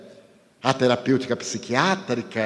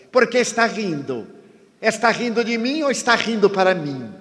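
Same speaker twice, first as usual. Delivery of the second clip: raised, needling challenge to a smiling stranger; mock provocation; 7 semitones higher than usual. Stranger in the check-in line taunting the speaker, needling and raised. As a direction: poke at him like a rude stranger. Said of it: "me desacatou a distância".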